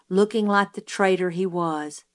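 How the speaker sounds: plain reading voice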